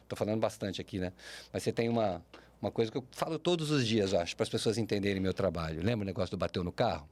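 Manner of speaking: ordinary delivery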